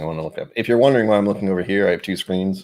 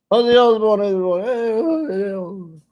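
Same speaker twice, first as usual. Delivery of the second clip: [name] impression; 12 semitones higher, 4 dB louder than usual. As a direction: call his name out like Ozzy. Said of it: "That's how he talks".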